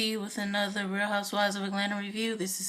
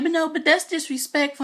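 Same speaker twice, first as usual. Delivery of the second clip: raised, higher mock indignation; mock indignant Kandi; 8 semitones higher, 5 dB louder than usual. Reality star scolding a late castmate, mock indignant.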